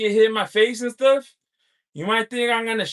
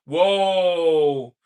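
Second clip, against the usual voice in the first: ordinary talk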